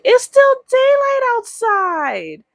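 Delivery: put-on high, slow, whiny kid voice; childish protest. Little girl to grandma, protesting bedtime in a high whine. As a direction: protest like a little kid sent to bed too early. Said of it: "And I'm like".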